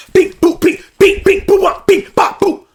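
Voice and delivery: high, loud mouth beeps for fighting; beep boop noises. Retold or imitated. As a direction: beep out the brawl playfully. Fight sounds censored by mouth.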